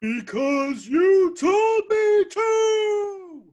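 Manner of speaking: high, stretched-out yell; battle cry. Acted. Contestant echoes his order, high drawn-out battle cry. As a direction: drag the last word into a long whoop.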